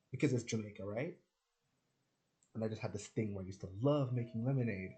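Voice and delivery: normal talking voice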